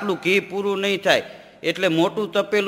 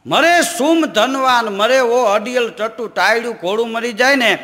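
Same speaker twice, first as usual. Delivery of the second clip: recited verse; 3 semitones higher, 3 dB louder than usual. Acted. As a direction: chant it like a folk poet. Repeats the verse. (ઊંચા સ્વરે દોહો બોલવો)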